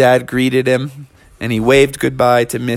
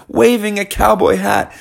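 ordinary delivery, nothing marked

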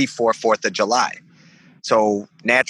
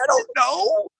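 very high, dopey shrug of a voice; answering his friends why, very high clueless squeak. Retold or imitated. Play it dumb, squeaky high.

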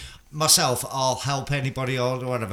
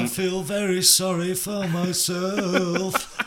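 slow, higher, self-pitying monotone drone; 7 semitones higher, much slower than usual. Retold or imitated. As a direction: wallow slowly in self-pity. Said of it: "It's very monotone".

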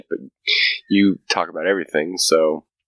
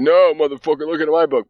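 high, louder mock retort; mock rival believer; 9 semitones higher, 4 dB louder than usual. Rival group arguing over holy books, high loud mock voice. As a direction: snap back over the book. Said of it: "They're like".

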